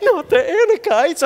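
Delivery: high, loud mock panic; hammed-up alarm at the dreaded name; freaking out. Israelites hearing who awaits them, mock panic. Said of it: "they're freaking out".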